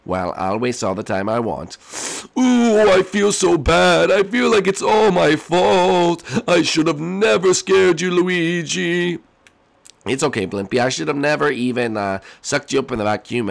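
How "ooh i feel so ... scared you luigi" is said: high, slow mock-sad apology; high sorry ghost voice.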